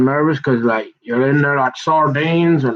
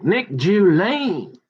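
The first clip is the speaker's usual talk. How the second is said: drawn-out, higher mimicked answer; imitating him; 5 semitones higher, much slower than usual. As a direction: stretch the name out slowly, mimicking him. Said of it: "And he's like".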